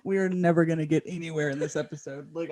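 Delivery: normal talking voice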